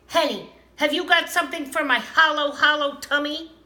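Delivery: greedy hurry for food; eager hungry elf. A narrator's reading. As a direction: rush it out, starving and impatient. Starving Tomten demands food.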